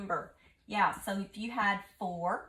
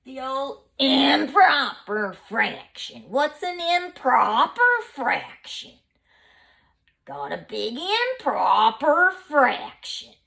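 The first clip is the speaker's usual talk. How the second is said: mock improper; 3 semitones higher, 8 dB louder, slower than usual. Shown as a demonstration. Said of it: "That looks strange".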